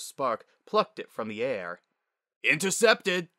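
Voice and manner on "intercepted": raised, loud father's play call; loud, high exclamation; triumphant yell. A narrator's reading. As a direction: yell it like a crowing sportscaster dad. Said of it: "he yelled".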